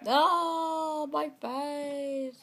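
high, loud mock wail; pained wail. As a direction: cry out as if your face hurts. Character hit in the face again.

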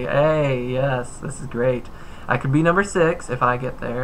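usual speaking voice

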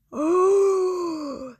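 loud high crying gasp. Real, not acted. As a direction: gasp as if hurt. Another friend revealed.

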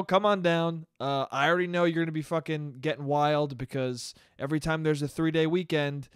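usual speaking voice

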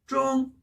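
"쫑" high, level and held; first tone. Shown as a demonstration. Teacher answering the first syllable, "쫑" high level tone. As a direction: hold "쫑" steady on a high note. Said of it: "앞 글자는 일 성이니까".

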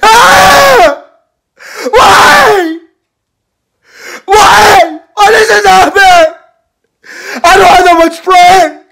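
high, loud wailing sobs; crying. Acted. Boy sobbing loudly after being kicked out. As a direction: wail and sob at the top of the voice. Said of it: "Yeah, I was crying".

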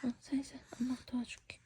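whispering. Real, not acted. Whispering to child.

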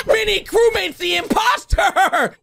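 high, slow, laughing outburst; laughing exclamation. Real, not acted. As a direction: cry it out through laughter. Laughing shock at the reveal.

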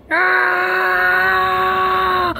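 long, high, held cry of pain. Acted. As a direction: wail it out like you're hurt. Character hurt, crying out.

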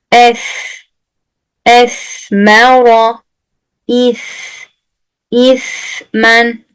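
"esmera", "ismen", "semenen" with lisped s, slow; lisped s words. Shown as a demonstration. Teacher reading the lisped s words aloud to students. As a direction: read each word with the tongue tip out. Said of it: "Bakın hep peltek çıkıyor".

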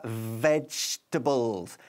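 "vegetables" very slowly with a buzzing V; vibrated V. Shown as a demonstration. Teacher showing the V sound, "vegetables" drawn out with buzzed V. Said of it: "con una V así vibrada".

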